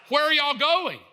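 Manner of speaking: high, loud, exasperated question; scolding wife. Acted. Wife grilling husband about his plans, scolding. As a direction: pitch it up and demand to know.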